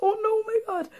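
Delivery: very high, scared little cry; frightened squeak. Retold or imitated. Hearing thunder in Mum's bed, a very high frightened cry. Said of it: "I heard the thunder and I was like".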